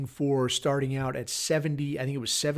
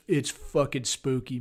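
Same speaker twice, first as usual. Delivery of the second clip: normal talking voice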